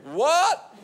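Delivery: high rising "What?"; mock hard-of-hearing. One-eared heckler answering the comic, mock hard-of-hearing query. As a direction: imitate a man straining to hear, pitch shooting up. Ear man feigns not hearing.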